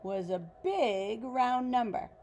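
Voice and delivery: put-on reading a picture book aloud to children; story narration. A narrator's reading. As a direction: tell it like a bedtime story for kids.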